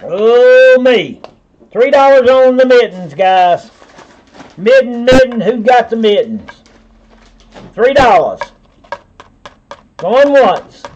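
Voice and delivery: rally the room like an auctioneer; auction call. Real, not acted. Seller calling for bids on the mittens, loud and high.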